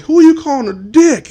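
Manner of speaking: high offended yelp; mock indignant. Heckler reacting to being called Dick, mock outrage. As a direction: act insulted. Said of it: "He's like".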